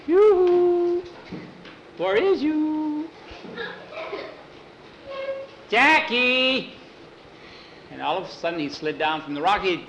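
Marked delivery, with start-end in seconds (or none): 0.1-6.7 slow calling out over distance. Acted. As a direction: sing out to someone hiding. Calling for missing friend.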